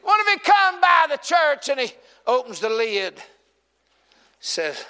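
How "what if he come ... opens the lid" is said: ordinary talk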